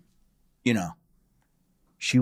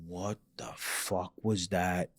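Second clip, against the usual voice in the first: much softer, slow scared question; frightened hush; 5 semitones lower, 11 dB quieter, slower than usual. Retold or imitated. Dad in bed after seeing a ghost, hushed and scared. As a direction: ask it under your breath, spooked.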